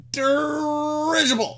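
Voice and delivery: put-on slow, slurred drunken mumble, laughing. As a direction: slur the word like a tipsy inventor. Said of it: "sounds like someone mumbling while drunk".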